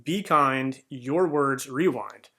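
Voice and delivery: slowed down, each word of the motto landing; slow deliberate repeat of the slogan for listeners; slow emphatic repeat. Shown as a demonstration. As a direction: spell it out slowly so it sticks. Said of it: "So we'll go back again one more time".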